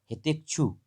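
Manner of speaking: ordinary talk, nothing marked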